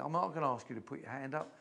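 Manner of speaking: normal talking voice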